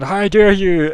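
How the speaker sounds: high, loud mock outrage; attention-seeking indignation. Game master imitates glowing companion demanding notice, mock indignation. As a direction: cry it out in showy, playful affront. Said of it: "your kind of attention-seeking".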